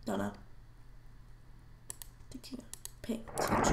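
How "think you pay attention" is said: hushed, teasing echo; mocking whisper.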